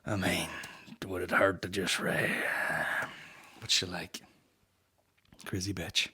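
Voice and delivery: soft, unvoiced whisper; hushed aside. Real, not acted. To listeners, a sheepish whispered aside after a dark joke.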